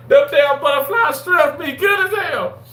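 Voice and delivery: high, loud raving; excited shout. Real, not acted. Host raving about Captain D's shrimp, shouting. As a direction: shout the praise like a fan.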